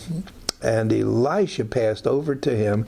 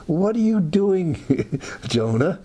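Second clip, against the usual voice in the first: high, slow, drawn-out challenge; a lightly voiced God calling him out; 6 semitones higher than usual. God confronting the hiding prophet, higher and slow.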